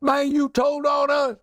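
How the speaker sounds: high, indignant yelp of disbelief; mock outraged gangster; shocked protest. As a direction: squeal like you just got betrayed. Accomplices accuse the snitch.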